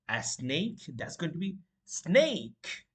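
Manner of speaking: ordinary talk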